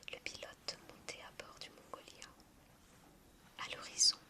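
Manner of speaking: plain reading voice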